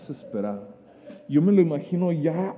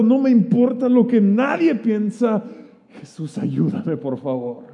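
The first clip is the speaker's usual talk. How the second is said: pleading; 4 semitones higher, 5 dB louder than usual. Retold or imitated. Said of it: "gritar desesperadamente".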